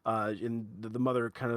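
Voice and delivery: ordinary talk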